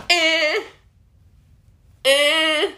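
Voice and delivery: sharp high squeal; high mock squeal. Squeak after a cheeky lyric, hammed-up. Exclamation sound.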